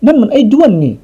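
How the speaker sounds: high eager recognition; excited exclamation. A narrator's reading. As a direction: cry out as you spot him. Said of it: "ร้องขึ้นพร้อมกันว่า". (ร้องด้วยความตื่นเต้น)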